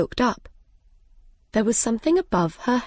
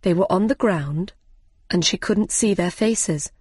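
usual speaking voice

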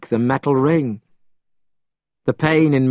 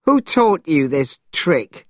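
higher, slow, aggrieved question; indignant demand; 6 semitones higher, slower than usual. A narrator's reading. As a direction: ask it slowly, voice raised in pitch. Monkey demands after the pain.

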